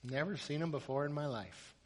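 very soft, understated answer; quiet denial. Acted. Quarterback telling the owner about the stranger, quiet denial. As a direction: say it hushed and matter-of-fact. Durant quietly disowns the fan.

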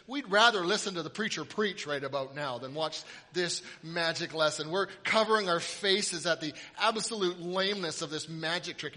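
ordinary delivery

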